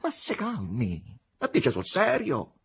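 high mocking incredulity; arrogant, derisive. A narrator's reading. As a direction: talk down to him, half jeering. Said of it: "con tono tra arrogante e derisorio". (tono tra arrogante e derisorio)

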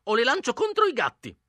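put-on high, fierce old woman; old woman threatening. Old woman threatens the boys with her cats. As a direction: threaten to set the cats on them. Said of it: "ripeté".

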